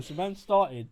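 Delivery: ordinary talk, nothing marked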